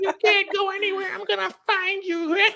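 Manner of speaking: very high, quick witch threat; hammed-up high witch voice; wicked witch. Mocking her hunt for the runaway, wicked witch voice. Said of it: "You just a witch".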